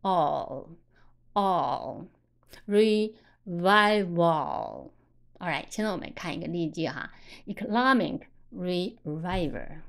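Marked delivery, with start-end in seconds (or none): 0.0-2.1 long, low "哦" with the tongue behind the upper teeth; dark L sound. Shown as a demonstration. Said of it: "把舌子顶在牙齿上齿的后面". 2.7-4.9 "revival" very slow, syllable by syllable, ending in "wol"; dark final L. Shown as a demonstration. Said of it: "它发哦".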